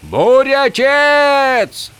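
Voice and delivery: very high, loud, long-stretched scream; drawn-out desperate yell. A narrator's reading. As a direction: bellow desperately after a departing god. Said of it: "заорал Холлин".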